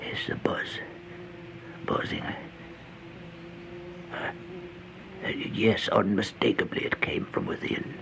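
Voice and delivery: soft, unvoiced whisper at the door; hushed whisper. A narrator's reading. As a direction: whisper so no one inside wakes. Listening at a door at night.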